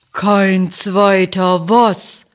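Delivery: slow, drawn-out cow question. A narrator's reading. Rosa the cow asking the others, slow and puzzled. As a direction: moo it out slowly, puzzled. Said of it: "fragt Rosa die Kuh".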